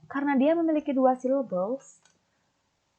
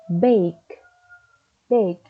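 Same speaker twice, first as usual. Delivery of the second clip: bake said loud with a falling stress; 2 semitones lower, 6 dB louder than usual. Shown as a demonstration. Teacher drilling students on stress, loud falling bake. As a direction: punch the start of bake, falling. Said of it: "Penekanan pada kata bake yaitu di awal suku".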